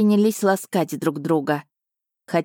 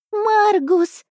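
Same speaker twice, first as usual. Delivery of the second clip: very high, louder squeal of the name; delighted squeal; 4 dB louder than usual. A narrator's reading. Cat Asha squeals at her returned partner, delighted and high. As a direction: shriek it with joy. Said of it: "От писка Аши заложила уши".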